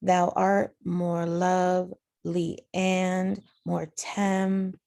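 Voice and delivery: slow two-syllable feet, "lovely" and "temperate" split; divided into iambs. Shown as a demonstration. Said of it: "do the same thing with the second line".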